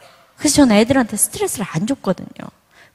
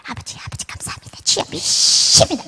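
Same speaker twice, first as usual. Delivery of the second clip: whispered prayer; 3 semitones higher than usual. Retold or imitated. Mother kneeling at dawn prayer, imitated as a hushed whisper. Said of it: "막 이러면서 기도하는데".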